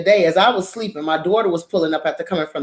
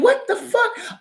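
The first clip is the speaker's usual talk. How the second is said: startled laughing what the fuck; slower than usual. Retold or imitated. Narrator startled to see the neighbor grinning at the barrier, high laughing disbelief. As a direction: pitch leaps way up, laughing in disbelief. Startled seeing her grin.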